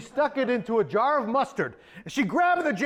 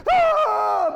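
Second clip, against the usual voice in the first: sudden loud high shriek; piercing villain scream; 10 semitones higher, 6 dB louder than usual. Acted. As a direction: shriek as the wand breaks. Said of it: "And she screamed".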